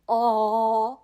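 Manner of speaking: "Aw" with the jaw dropped wide; open-jawed vowel. Shown as a demonstration. Learner demonstrating the Korean vowel, "Aw" with wide-open jaw. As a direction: hold "Aw" as a long open vowel. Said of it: "your jaw has to be really open".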